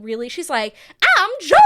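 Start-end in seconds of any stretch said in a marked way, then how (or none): 1.2-1.6 loud, high, exaggerated Southern accent; mock Southern accent. Co-host mocks Georgia's accent on the show, exaggerated fake Southern. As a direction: imitate a badly faked Southern accent. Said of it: "Georgia's accent each season".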